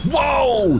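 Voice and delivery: sudden loud, high whoa; startled whoa. Real, not acted. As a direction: jump at the sight. Startled by new enemy.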